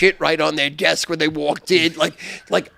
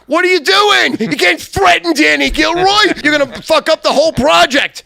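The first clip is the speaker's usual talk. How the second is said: higher, louder angry voice of Brandon; angry retold rant; 11 semitones higher, 9 dB louder than usual. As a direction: yell at him for ruining the project.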